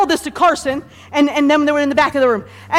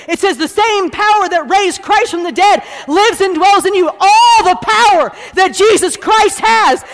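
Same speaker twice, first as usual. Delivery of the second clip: raised, driving pulpit delivery; full-force preaching; 6 semitones higher, 4 dB louder than usual. Real, not acted. Preacher telling congregation they have authority, full force. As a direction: preach it hard, punching key words.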